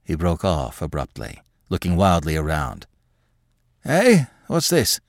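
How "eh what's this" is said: put-on high, startled old man; sudden confused outburst; startled cry. A narrator's reading. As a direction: jolt out of the vision. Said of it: "he cried".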